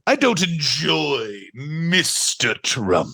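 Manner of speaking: slow snooty put-on voice on I don't enjoy Mister Trump; snooty mock Rosie. Host repeats Rosie's line in a slow snooty voice. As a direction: draw it out like a pompous diner. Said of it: "That's a real".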